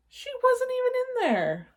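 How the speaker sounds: high startled storyteller; surprised. Acted. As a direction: gasp it out in surprise. Surprise at empty room.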